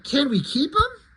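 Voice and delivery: put-on pleading kid. Kid asks grandpa for dog.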